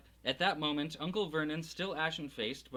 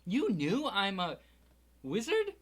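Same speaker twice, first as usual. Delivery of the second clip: disbelieving, rising higher; can't believe it; shocked question; 6 semitones higher, 6 dB louder than usual. Acted.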